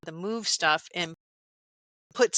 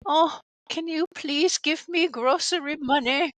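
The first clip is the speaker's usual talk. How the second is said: higher, louder sheepish asking voice; pleading for money; 8 semitones higher, 4 dB louder than usual. Retold or imitated. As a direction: beg sweetly for grocery money. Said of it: "having to be like".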